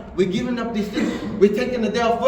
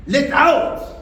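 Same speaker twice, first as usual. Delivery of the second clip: louder, higher burst; sudden outburst; 5 semitones higher, 4 dB louder than usual. Acted. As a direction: release it in a sudden call. Inner voice bursts out.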